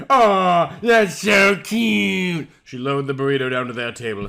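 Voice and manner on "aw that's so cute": high, loud, drawn-out gush. Acted. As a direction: coo over something adorable. Waitress gushing over couple.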